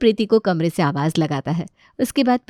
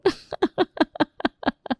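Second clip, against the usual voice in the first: sly villain laugh; 8 semitones higher than usual. Acted. [name]'s secret villain laugh.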